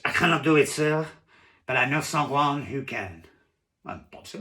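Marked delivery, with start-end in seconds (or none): 0.0-3.2 higher, louder French waiter; French waiter accent. Acted. Waiter refuses diner's order, in a French accent. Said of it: "I can't do a French accent".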